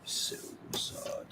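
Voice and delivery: hissed vampire whisper, voiceless. Acted. Host voicing a vampire in a whisper. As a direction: breathe it like a lurking vampire. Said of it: "That was the vampire".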